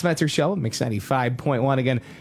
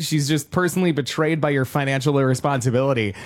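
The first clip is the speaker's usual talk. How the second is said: normal talking voice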